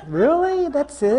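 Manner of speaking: high, loud mock disbelief. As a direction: act out your stunned letdown. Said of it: "I'm like".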